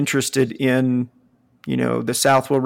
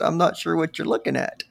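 ordinary talk

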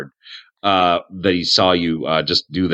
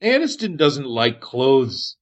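slow, swooping higher hermit voice; Aniston, dotty cave hermit; 6 semitones higher, slower than usual. Acted. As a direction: explain it slowly as an odd loner.

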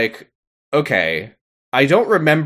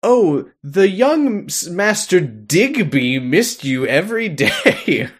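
slow, lilting put-on maid; 6 semitones higher, slower than usual. Maid greeting the returning governess, slow put-on gushing. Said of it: "the maid is like".

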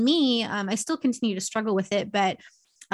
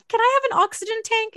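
much higher pleading ask; pleading request; 13 semitones higher, 4 dB louder, faster than usual. Retold or imitated. Asking her doctor with a high mock plea. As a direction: beg the doctor like a child. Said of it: "I asked my doctor".

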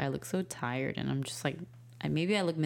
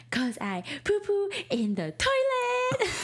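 put-on very high, slow little-boy voice breaking into a laugh; proud toddler voice; 4 dB louder, slower than usual. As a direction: announce it like a proud potty-trained kid. Mom voices toddler's proud answer.

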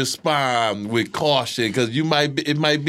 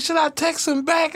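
high, bewildered wondering; incredulous question; 11 semitones higher than usual. Retold or imitated. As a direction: ask it up high in disbelief. Wondering aloud, shocked.

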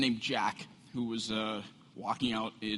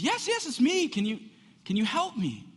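desperate plea higher; 14 semitones higher, 6 dB louder than usual. Acted. Desperate Jack answers voice.

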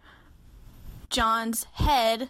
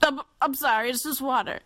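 flustered apology; 4 semitones higher than usual. Retold or imitated. Retold flustered apology after spitting.

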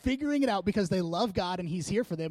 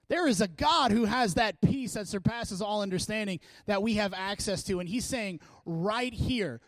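usual speaking voice

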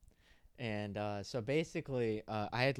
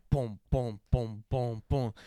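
punchy, evenly spaced booms; mouthed bass drum, loud and rhythmic; imitated hardbass beat; 4 dB louder, slower than usual. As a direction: thump out a club bass beat. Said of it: "They're just going".

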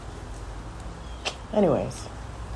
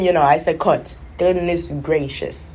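ordinary talk

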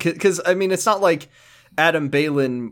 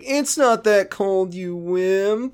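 high, drawn-out teasing imitation; mock taunt; 6 semitones higher, much slower than usual. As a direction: stretch out a jeering put-on voice, pitched up. Acts out Josh taunting Andy.

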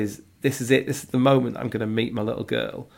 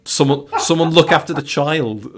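louder, higher mock-urgent appeal; exasperated plea; 4 semitones higher, 5 dB louder than usual. Dad redirecting midwives to the child, exasperated plea. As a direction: call out in comic exasperation.

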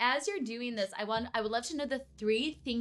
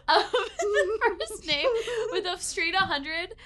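high, loud and drawn out through laughter; pitched up, breaking into giggles; laughing; 11 semitones higher, 7 dB louder, slower than usual. Real, not acted. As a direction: laugh through the words, stretching them. Game master laughs reading a perfect score.

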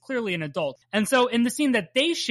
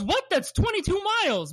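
startled retort; 11 semitones higher than usual. Retold or imitated. Scott's startled reply in story.

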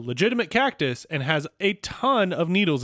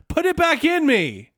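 yelling; 10 semitones higher than usual. Retold or imitated. Fry yelling quote.

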